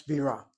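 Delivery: "Birah" said bi-RAH, accent on the end; stress on second syllable. Shown as a demonstration. Teacher giving the word for capital, second syllable of "Birah" stressed. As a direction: land firmly on RAH in "Birah". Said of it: "accent on the second syllable".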